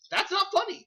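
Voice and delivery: high-pitched comic objection; exaggerated high disbelief; mock indignant protest. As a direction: protest it in a squeaky put-on pitch. Quotes own protest at laughter.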